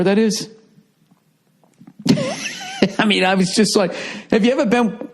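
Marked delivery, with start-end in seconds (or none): 2.0-2.9 a high squealing laugh; a sudden high laugh; high burst of laughter. Real, not acted. Laughing at own immaturity.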